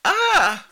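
a short, very high and loud little yelp; tiny mock scream. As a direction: squeak out a small fake scream. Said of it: "but just a little".

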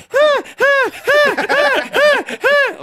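very high, loud staccato "ha ha"; mock high laugh. Traveler imitating the locals' reaction, shrill mock laughing.

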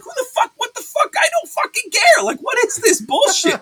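shouting way up high, fed up; a sudden outraged yell at the screen; furious outburst. Retold or imitated. Reviewing a bad episode to his co-host, furious high shout. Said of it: "And I'm just like".

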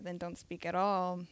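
usual speaking voice

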